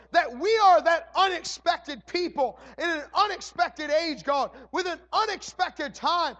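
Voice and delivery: loud, high, fervent prayer building to a shout; preaching at full force. Acted. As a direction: cry it out to heaven with rising fire.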